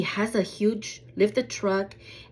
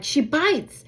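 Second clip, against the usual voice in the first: raised, higher-pitched warning; retold warning; 7 semitones higher, 4 dB louder than usual. Owner warning stranger about her dog, raised warning. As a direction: warn sharply with a lift in pitch. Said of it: "I'm like".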